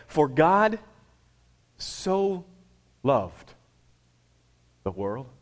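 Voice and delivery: each word set apart by long pauses; solemn slow scripture reading. Shown as a demonstration. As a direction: read it slowly, word by word. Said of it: "read it really slowly".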